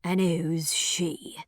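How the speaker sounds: villain demanding answers, very slow and cold; grating stranger. Acted. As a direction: stretch each word like a threat. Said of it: "asks a grating voice".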